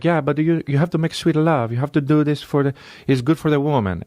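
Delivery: high-pitched, pleading Italian caricature; mock Italian accent. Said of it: "an Italian guy's like".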